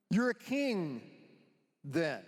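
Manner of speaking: lightly acted governor, dropping on the last word; pause, then let the final word fall; Pilate. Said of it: "I'm gonna be Pilate".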